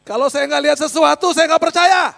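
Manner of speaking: high, loud declaration of doubt; defiant shout. Retold or imitated. Sceptics demanding to see reality first, shouting defiantly.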